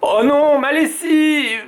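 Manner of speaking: gémissement aigu et fort, traînant; papa gémit. A narrator's reading. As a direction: se lamenter pour sa lessive. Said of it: "gémit papa". (papa gémit, voix aiguë)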